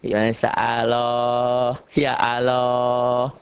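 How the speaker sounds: slow Sundanese-style "Allah" as "aaloh"; drawn-out Sukabumi pronunciation of "ya Allah"; Sukabumi accent. Acted. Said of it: "A A Ari dari Sukabumi".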